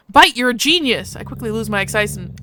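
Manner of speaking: ordinary talk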